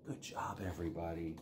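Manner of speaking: whispered praise so monster sleeps. Real, not acted. Teacher whispering praise so the monster sleeps. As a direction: breathe it softly, no voice. Monster falls asleep, hushing.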